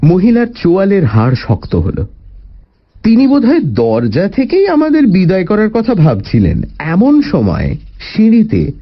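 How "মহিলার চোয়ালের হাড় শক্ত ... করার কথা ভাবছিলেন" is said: plain reading voice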